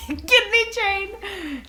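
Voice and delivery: laughing. Real, not acted. Laughing at the kidney chain name.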